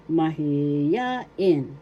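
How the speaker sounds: Mahiyain with "ya" drawn out and stressed; stress on third syllable. Shown as a demonstration. Teacher modelling the Tagalog word for shy, stressed "ya" in Mahiyain. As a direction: lean on the "ya" in Mahiyain, then finish on "in". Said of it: "The third syllable is where the accent is".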